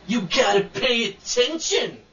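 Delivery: slow, lightly voiced snooty DJ; condescending DJ. Retelling the DJ's snub, slow mock condescension. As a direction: mimic a talking-down DJ. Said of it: "Totally condescending and rude".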